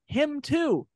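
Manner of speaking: high-pitched stranger pointing at his face; mimicking a stranger. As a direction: squeak it out while pointing. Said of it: "just going".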